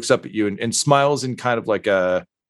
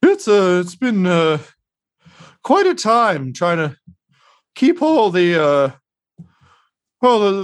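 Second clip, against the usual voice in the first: higher and louder, a tired, rambling host; Mosifus Dirt, weary; 10 semitones higher, 3 dB louder than usual. Acted. Mosifus Dirt telling the party about the hard winter, weary and pitched up. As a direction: sigh through the winter woes, pitched up. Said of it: "at you all and says".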